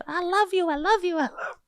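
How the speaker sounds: high, gushing auntie-style declarations; proud auntie. Retold or imitated. Mimicking mob who gush love when they see you. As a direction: lay the love on like a doting aunty. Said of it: "They sound like proud aunties".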